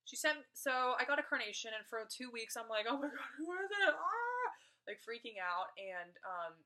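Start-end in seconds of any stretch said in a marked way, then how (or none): 2.8-3.9 high, fast, giddy rush of words; excited squeal. Retold or imitated. Retelling her excitement over the card. 4.0-4.5 a high, loud, giddy squeal; excited shriek. Retold or imitated. Retelling her excitement over the card.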